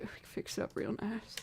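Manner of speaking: soft whispered reassurance. Pretend. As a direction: breathe it out as a whisper. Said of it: "going into this southern accent".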